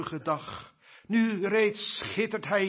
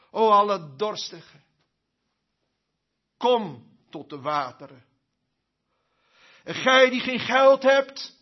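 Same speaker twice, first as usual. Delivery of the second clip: full-force preaching; 2 semitones higher, 9 dB louder than usual. Acted. Preacher urges the congregation to come, loud and fervent. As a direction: plead with the whole church to come.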